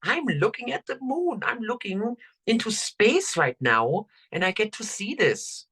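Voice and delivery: put-on high-pitched awestruck seven-year-old; freaking out with wonder. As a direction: imitate an amazed child, pitched well up. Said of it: "just totally freaking out".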